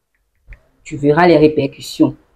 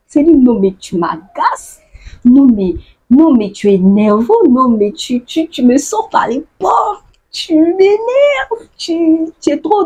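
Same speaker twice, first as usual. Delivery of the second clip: mock irritation; 8 semitones higher than usual. To someone who annoys you, hammed-up irritation. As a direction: act fed up with someone. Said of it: "acting out annoyance".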